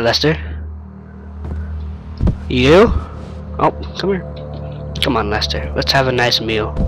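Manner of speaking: ordinary talk, nothing marked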